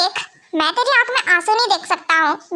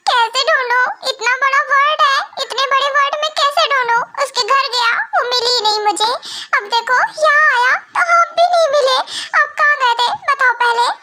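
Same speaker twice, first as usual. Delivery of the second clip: higher, whiny mock sobbing tone; tearful complaining; 4 semitones higher than usual. Younger brother blaming Yoong for being away, tearful complaining. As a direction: plead helplessly, on the verge of wailing. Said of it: "ऐसे ना रोना बंद कर दे".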